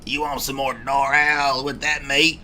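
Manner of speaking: lightly played chain-smoking diner waitress; diner waitress cigarette voice. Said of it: "diner waitress cigarette voice too".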